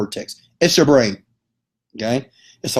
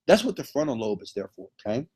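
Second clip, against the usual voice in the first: normal talking voice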